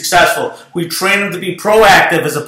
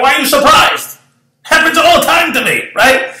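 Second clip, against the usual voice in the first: playful, much higher, breezy brag; mock casual; 10 semitones higher than usual. Boss teasing his stunned agent, mock casual. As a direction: toss it off like it's nothing special.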